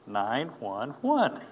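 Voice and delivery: slow, one digit at a time; deadpan literal answer. Retold or imitated. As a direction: spell out the digits slowly for the joke. Joking literal answer on dialing.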